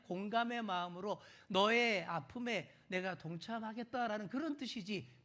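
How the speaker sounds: ordinary delivery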